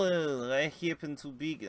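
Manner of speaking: ordinary talk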